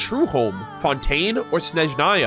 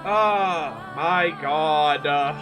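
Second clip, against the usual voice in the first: overwhelmed; much slower than usual. Real, not acted. Overwhelmed by the story, long pause.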